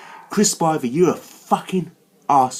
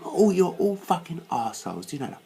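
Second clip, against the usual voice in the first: ordinary delivery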